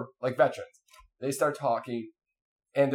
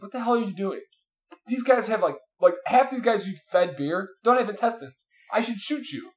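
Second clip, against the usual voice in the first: high-pitched, indignant mock reprimand; retold scolding; 6 semitones higher than usual. Commanding officer catches Vince feeding patients beer, mock outrage.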